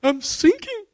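high, drawn-out desperate wail; crying out. Acted. Sinking believer calls to the Lord for help, crying out. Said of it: "you cry out".

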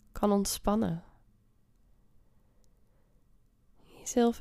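guided meditation. Acted. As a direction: ease them into calm. Leads a meditation.

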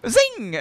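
high, sharp zing effect; high zing sound. Acted. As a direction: make the bullet zing. Repeats the bullet sound.

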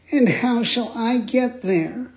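high, slow eager puppet voice; Pinocchio voice. Acted. Pinocchio asks.

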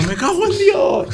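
very high, slow, hammed-up furious yell. Friend arriving with a machete, retold as a high furious yell.

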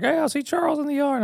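put-on high, excited little boy voice; piping up like an excited kid; imitating young son. Dad voicing his son spotting the squirrel, high childlike.

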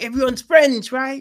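put-on high, loud sing-out to small children; rally a room of little kids; acted infant-teacher enthusiasm; teacher to six-year-olds. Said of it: "when you've got little fucking six-year-olds".

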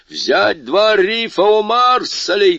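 high, slow captain's shout; shouted order. A narrator's reading. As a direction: call it over the storm. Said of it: "крикнул капитан".

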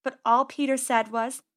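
plain reading voice

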